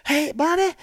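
very high, slow mock Southern drawl; exaggerated high hillbilly impersonation; Crazy Bobby. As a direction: imitate a cartoonish country oddball, stretched out and squeaky-high. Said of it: "He goes".

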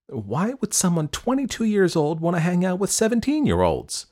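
mother's pointed question, pitched high; high, insistent questioning of a daughter. A narrator's reading. Mother questioning her daughter's older friend, high and probing. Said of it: "Her mother once questioned her".

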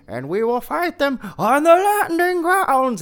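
booming, high theatrical oration; bombastic Churchill speech. Retold or imitated. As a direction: belt it like a grand wartime leader. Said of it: "Gary Oldman is giving a, a performance like".

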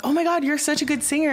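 high, quick fangirl compliment; imitating naive, excited admiration; gushing praise. Retold naive gushing to a singer.

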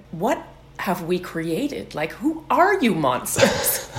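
comic appalled outburst, building in pitch; mock horror. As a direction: play the aghast parent, stretching the words. Acts parents aghast at their children.

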